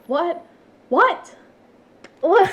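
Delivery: high, loud yelps of disbelief; mock screaming. Retelling how the streamer reacts in game, mock screaming. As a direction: imitate a streamer's incredulous outburst. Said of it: "it's like he's screaming".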